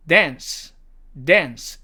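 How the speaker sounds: American "dance", front a; American demo of "dance"; American accent. Shown as a demonstration. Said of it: "미국식 발음으로는".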